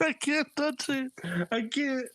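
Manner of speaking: high-pitched mock panic; hammed-up squeamish protest. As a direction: retell a grossed-out refusal, voice way up. Retold panic at touching puke.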